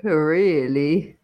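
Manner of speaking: very slow, drawn-out posh British; posh British accent. Shown as a demonstration. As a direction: stretch it into would-be posh British. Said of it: "like, a posh British accent?".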